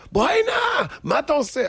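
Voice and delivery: high-pitched scolding of a child, acted out; mock angry scolding. Family chasing a boy who broke things, mock angry shout. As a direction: imitate an angry parent calling the child over.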